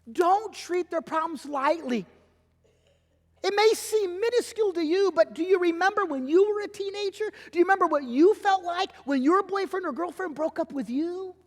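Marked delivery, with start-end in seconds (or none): none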